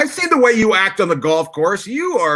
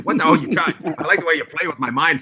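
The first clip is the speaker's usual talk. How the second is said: usual speaking voice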